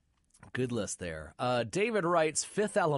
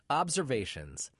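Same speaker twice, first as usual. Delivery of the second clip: normal talking voice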